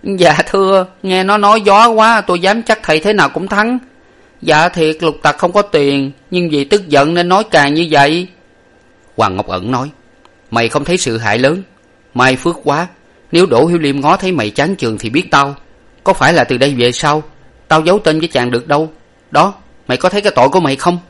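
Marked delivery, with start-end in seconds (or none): 0.0-8.3 high-pitched servant making excuses; raised, deferential servant voice; sniggering servant. A narrator's reading. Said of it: "cười khịch khịch trong miệng và nói". 10.5-21.0 lightly voiced master, pitch lifted; a touch of reproach from the master; stern master scolding. A narrator's reading.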